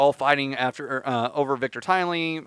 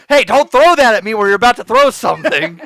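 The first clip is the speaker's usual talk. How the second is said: high, loud mocking quote; mock scolding; 6 semitones higher, 9 dB louder than usual. Host mimicking co-host's hat threat, loud mock scolding. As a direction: parody someone's indignant warning. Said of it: "You can't be like".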